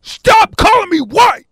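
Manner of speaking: high, loud, slow shout; looking-to-kill anger. Acted. Man fed up with the insult, furious shout. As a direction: roar it out with every word stretched. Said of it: "I'm looking like I'm gonna kill".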